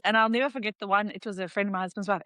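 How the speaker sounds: normal talking voice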